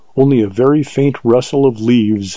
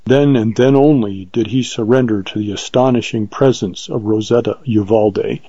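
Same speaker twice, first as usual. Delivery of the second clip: usual speaking voice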